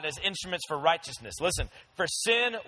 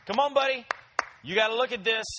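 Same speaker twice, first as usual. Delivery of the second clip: coaxing tempter; 4 semitones higher, faster than usual. Pretend. Sin tempting late at night.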